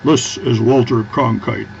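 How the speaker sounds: slow, formal anchorman delivery; news anchor voice. Retold or imitated. Canadian vlogger showing his network news voice, slow anchor read. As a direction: announce it like an old network newsman. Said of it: "my N B C voice or my news voice".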